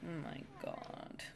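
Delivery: breathy whispered disbelief. Real, not acted. Reaction to creepy line.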